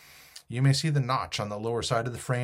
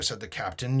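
usual speaking voice

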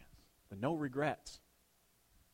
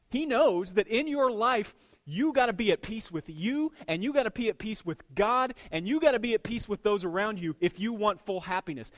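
louder, higher driving preacher cadence; preaching at full force; 5 semitones higher, 5 dB louder than usual. Real, not acted. A pastor urging his congregation toward peace, louder and higher. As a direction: press each gotta home like a revival sermon.

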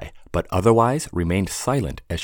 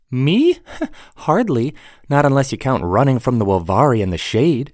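higher, light laughing dismissal; laughing young woman; 7 semitones higher, 4 dB louder than usual. Acted. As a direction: brush it off with a laugh. Sarah laughs it off.

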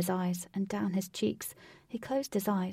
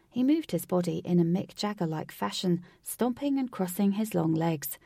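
plain reading voice

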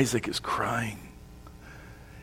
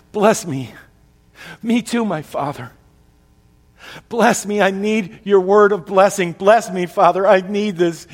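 higher and louder, pleading over and over; desperate begging; 6 semitones higher, 6 dB louder than usual. Acted. Son begs his father for a blessing, higher and louder. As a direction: beg your father as if broken.

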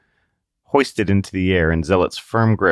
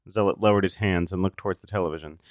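ordinary talk

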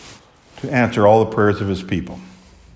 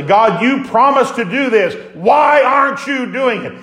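bold prayer; 11 semitones higher, 3 dB louder than usual. Retold or imitated. Believer praying on their face, loud pleading cry. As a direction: cry out holding God to his word. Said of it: "get on your face before God and say".

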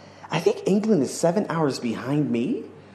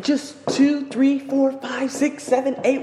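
counting out steps much higher, giddy; excited counting steps; 13 semitones higher, slower than usual. Real, not acted. Vlogger counting his steps to the viewers, giddy high counting. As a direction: count each step like a madman. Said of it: "I'm stepping like I am a madman".

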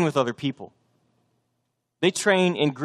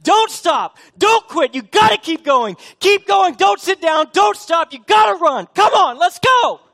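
screamed, much higher and louder; coach yelling; 9 dB louder than usual. Retold or imitated. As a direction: yell at a runner on the last leg, all out. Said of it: "He's like".

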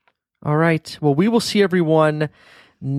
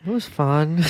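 imitating daughter; 4 semitones higher, slower than usual. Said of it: "you always pause for a second and you go".

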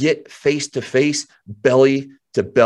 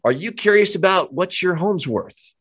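cheery door-knock pitch; 7 semitones higher than usual. Shown as a demonstration. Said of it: "and say".

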